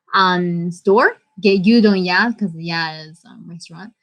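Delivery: ordinary talk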